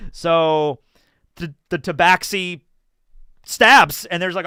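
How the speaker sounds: usual speaking voice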